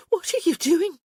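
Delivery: high, loud startled gasp of a question; shocked as he lifts her up; startled woman being carried off. A narrator's reading. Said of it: "she gasped".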